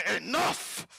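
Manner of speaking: explosive roar to end the argument; lizard outburst. Acted. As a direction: growl into a high, loud shout. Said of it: "And then the lizard goes".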